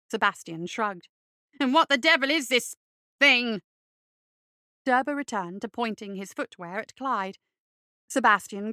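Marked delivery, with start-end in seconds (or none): 1.6-3.6 raised, affronted fop voice; outraged and a touch shrill; indignant fussy bureaucrat. Acted. Derber character voice.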